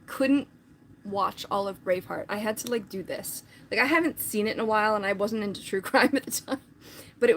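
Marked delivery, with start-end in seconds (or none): none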